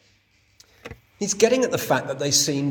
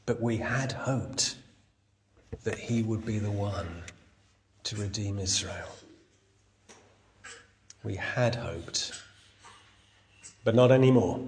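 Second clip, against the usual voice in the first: mild sad; 4 semitones lower, 4 dB quieter than usual. Retold or imitated. Disciples' lost hope, quoted.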